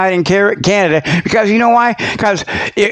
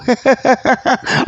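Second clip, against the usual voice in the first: short amused laugh; 6 semitones higher than usual. Real, not acted. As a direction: laugh at calling Calvin a weirdo. Laughs at weirdo remark.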